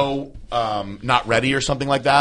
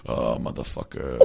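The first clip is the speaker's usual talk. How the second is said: very low, soft, slow grumble; rambling to self; 14 semitones lower, 12 dB quieter, slower than usual. Retold or imitated. Recalling unknowingly being live, rambling to self. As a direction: imitate yourself grumbling under your breath. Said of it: "I kept on going".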